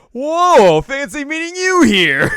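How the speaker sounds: very loud, high, drawn-out mock surprise; feigned surprise. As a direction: act hugely, badly surprised to bump into them. Ren feigns surprise at meeting.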